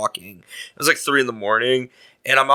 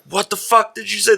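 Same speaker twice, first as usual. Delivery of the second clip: imitated indignant challenge; 9 semitones higher than usual. Passer-by confronting a heckler, imitated indignation. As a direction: act out an affronted passer-by.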